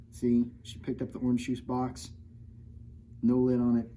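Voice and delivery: plain reading voice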